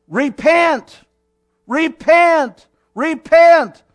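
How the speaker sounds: loud, high prophet's cry, each one punched. Acted. John calling the crowds to change, crying out. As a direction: cry it out like a wilderness preacher. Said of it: "This is what he's crying".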